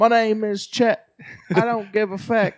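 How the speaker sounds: hammed-up [name] rap persona; mock [name]. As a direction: brag like a cocky young rapper. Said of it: "is that all right".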